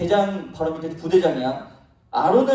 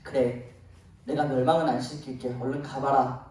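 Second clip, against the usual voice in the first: softer, lower, giving in; God relenting to Moses, quiet and lower; relenting softly; 7 semitones lower, 6 dB quieter than usual. Retold or imitated. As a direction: let it go, quietly. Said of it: "하나님이 마음을 돌리셨어요".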